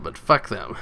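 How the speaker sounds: ordinary talk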